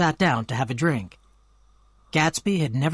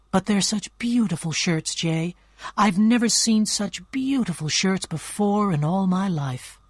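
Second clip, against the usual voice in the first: tearful; 6 semitones higher than usual. A narrator's reading. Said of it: "began to cry".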